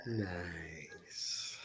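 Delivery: drawn-out, teasing whisper. Acted. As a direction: stretch "nice" into a hushed breath.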